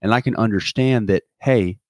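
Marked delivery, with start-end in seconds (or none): none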